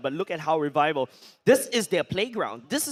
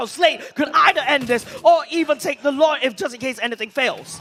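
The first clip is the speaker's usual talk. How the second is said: loud, high, fast-paced shoutcast call; excited play-by-play; 8 semitones higher, 9 dB louder than usual. Real, not acted. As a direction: call the teamfight at full hype.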